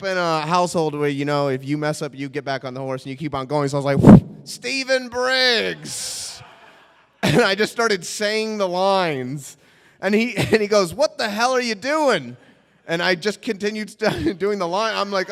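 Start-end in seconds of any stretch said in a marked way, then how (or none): 4.4-6.4 very drawn-out, high-pitched name; determined slate. Retold or imitated. Carries on slate after throwing up. 10.9-12.4 higher-pitched mock outrage; appalled outburst. Acted appalled casting man.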